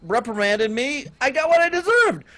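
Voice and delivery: high, earnest insistence to police; insisting kid. Retold or imitated. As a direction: push hard to convince them. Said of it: "I told them".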